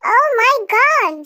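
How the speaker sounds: ordinary talk, nothing marked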